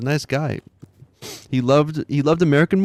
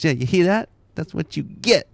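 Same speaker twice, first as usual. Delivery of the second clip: higher, gleeful imitation of the son; son's taunt; 7 semitones higher than usual. Imitating his son repeating the prank line, higher gleeful taunt. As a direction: gloat like a kid. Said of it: "He goes".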